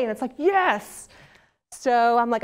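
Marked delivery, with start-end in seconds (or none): 0.4-1.1 thrilled, high-pitched yes; a delighted burst of discovery; excited realization. Retold or imitated. Young girl finding Hollywood next to her dream school, excited. Retells her youthful eureka moment.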